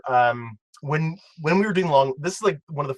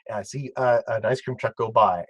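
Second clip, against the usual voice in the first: ordinary delivery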